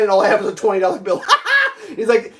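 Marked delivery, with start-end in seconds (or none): 0.0-1.9 fast, laughing at himself. Real, not acted. Telling viewers about his twenty dollar bill, laughing. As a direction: laugh through the confession.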